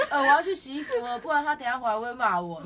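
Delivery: mock the sobbing friend while laughing; laughing quote. Retelling friend's crying call, laughing through the quote. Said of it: "他说".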